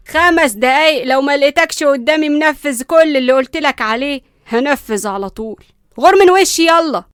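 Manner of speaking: loud commanding ultimatum; loud order. Acted. Nanny orders the boy upstairs, loud command. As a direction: bark the order to get out.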